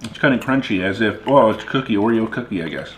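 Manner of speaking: eating chocolate candy; chewing while talking. Real, not acted. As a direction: mouth partly full. Seen 1: Man lifts candy bits to mouth, chewing while describing the taste.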